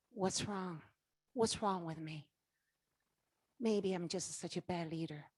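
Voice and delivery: soft, sinking, lost voice; quietly doubting yourself; despairing self-doubt. Retold or imitated. A discouraged leader asks herself what's wrong, softly. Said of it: "And I'm there saying".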